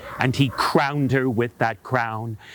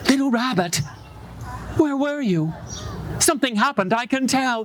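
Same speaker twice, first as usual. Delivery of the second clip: mama rabbit; 9 semitones higher, faster than usual. Acted.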